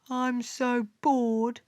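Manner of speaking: slow, put-on bored voice; bored muttering. A narrator's reading. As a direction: drag the words out with boredom. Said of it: "muttered Mercury".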